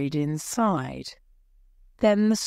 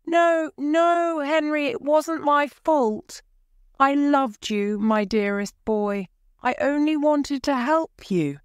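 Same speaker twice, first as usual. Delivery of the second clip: desperate pleading; 10 semitones higher than usual. A narrator's reading. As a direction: beg for forgiveness in a panic. Aunt pleads desperately with ghost.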